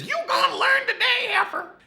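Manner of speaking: squealing high threat through laughter; Misha threatening. Retold or imitated. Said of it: "But Misha says".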